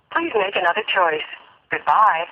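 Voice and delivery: usual speaking voice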